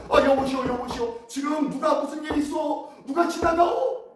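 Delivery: high-pitched, urgent call to passers-by. Acted. Blind beggar asks the crowd who is passing, calling out. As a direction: cry out the questions, pitch climbing at the end. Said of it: "사람들에게 소리치며 묻습니다".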